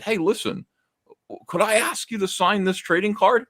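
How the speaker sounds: put-on high, sweet and careful young fan asking; timid fan's politest voice; overly polite request. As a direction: ask like a nervous kid trying his best manners. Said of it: "I asked him so politely".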